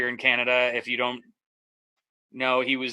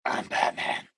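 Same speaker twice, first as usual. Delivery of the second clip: Batman voice; 4 dB quieter, slower than usual. Pretend. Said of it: "very much like Batman".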